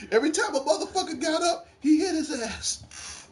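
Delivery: usual speaking voice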